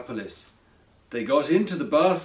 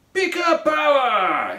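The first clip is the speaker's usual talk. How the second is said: heroic yell; 10 semitones higher, 7 dB louder than usual. A narrator's reading. Pierre charging to free Craig, yelling. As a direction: yell it like a superhero. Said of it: "he yelled".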